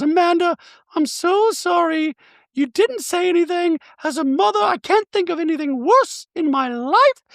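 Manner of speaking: high, prim voice for Dorit; Dorit impression. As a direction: play Dorit sweetly apologetic in a high voice. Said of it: "Dorit comes to the front door".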